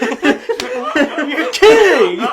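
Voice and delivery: a huge, high, drawn-out laughing yell; laughing shriek. Real, not acted. Reacting to the fan fiction, a long high laughing shriek. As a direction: shriek it through laughter, unable to believe it.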